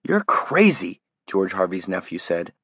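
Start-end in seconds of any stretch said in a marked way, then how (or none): 0.0-1.0 a hint of frightened, higher. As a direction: blurt it out scared. Terrified kid accusing Greg.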